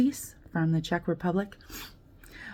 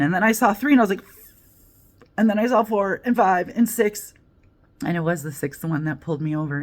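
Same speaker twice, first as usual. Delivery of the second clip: ordinary talk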